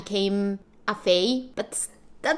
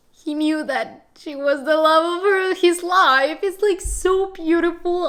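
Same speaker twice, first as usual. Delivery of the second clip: swooning excitement; 7 semitones higher, 5 dB louder than usual. Real, not acted. Overwhelmed by romance.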